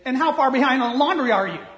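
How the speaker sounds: loud snide comeback; sarcastic retort. Acted. Acted retort during argument.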